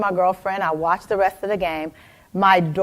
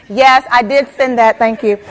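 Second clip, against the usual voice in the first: brisk conference call reply; 3 semitones higher, 6 dB louder than usual. Retold or imitated. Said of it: "I unmute".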